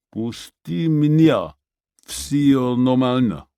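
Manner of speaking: slow, dazed Russian insisting he's fine; groggy. Acted. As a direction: push them off, still foggy. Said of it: "sounding groggy".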